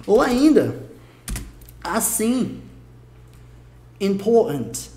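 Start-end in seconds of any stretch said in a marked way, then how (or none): 4.0-4.9 "important" as "impo'ant", without R or middle T; R and T dropped. Shown as a demonstration. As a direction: eat both the R and the T in "important", London style. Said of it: "come o R e também come o T".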